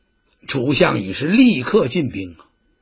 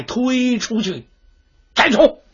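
high barked order to behead; a general's ringing command; 6 semitones higher, slower than usual. Acted. General orders his men to behead the envoy, high ringing command.

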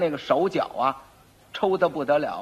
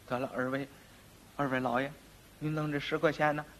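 low, hushed, wheedling aside; furtive bribe; 4 semitones lower, 11 dB quieter than usual. Acted. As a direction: slip the offer in softly, confidentially. Furtively bribing two officers aside.

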